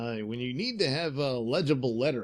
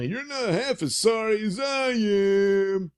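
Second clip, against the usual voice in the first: higher, louder crook voice; thug voice; 9 semitones higher, 5 dB louder, slower than usual. Acted. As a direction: whine it like the captured thug. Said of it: "that's the other guy".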